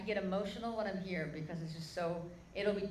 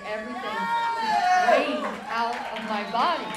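loud, slow and higher, rising to a cry; preaching at full force; 4 semitones higher, 14 dB louder, slower than usual. Acted. Preacher telling the crowd of the healing, at full force. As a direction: belt it out to the crowd.

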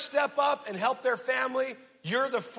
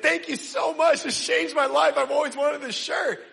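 hammed-up tearful thanks, higher and louder; mock weeping gratitude; 5 semitones higher, 4 dB louder than usual. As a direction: imitate someone overcome with gratitude. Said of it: "like they're weeping".

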